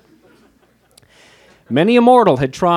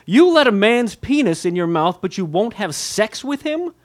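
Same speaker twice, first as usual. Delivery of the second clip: imitated disbelief, high and rising at the end; higher, mock-astonished questioning; mock incredulous; 8 semitones higher, 4 dB louder than usual. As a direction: mimic a baffled interviewer's question, pitched up. Imitates the host's incredulous repeated question.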